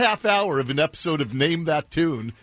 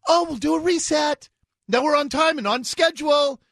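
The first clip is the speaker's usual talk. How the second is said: mocking; 10 semitones higher than usual. Mocks agency's claims.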